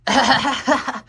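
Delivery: a bright burst of laughter at the cat; giggling at the new kitten; high delighted laugh. Real, not acted.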